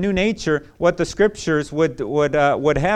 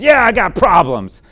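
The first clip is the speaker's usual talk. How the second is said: put-on angry teen; 5 semitones higher, 7 dB louder than usual. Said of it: "this guy was just angry".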